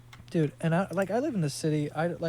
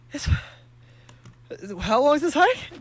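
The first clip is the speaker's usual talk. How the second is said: out of breath; 9 semitones higher, 4 dB louder than usual. Retold or imitated. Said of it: "I was out of breath".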